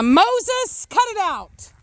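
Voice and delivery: high, sharp yell at a misbehaving dog. Real, not acted. Yelling across the yard at the other dog Moses, a high sharp yell. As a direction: shout him off whatever he's doing. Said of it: "Sorry, I had to yell at Moses".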